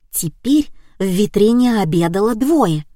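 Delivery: ordinary delivery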